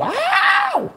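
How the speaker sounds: sudden high yelp of pain. Real, not acted. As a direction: yelp as the hot water burns. Burned by hot water.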